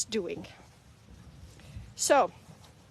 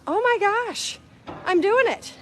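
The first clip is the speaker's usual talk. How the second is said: high, loud burst of discovery; sudden realization; 9 semitones higher, 5 dB louder, slower than usual. Retold or imitated. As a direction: it hits you mid-mop: oh my gosh. Sudden realization while mopping.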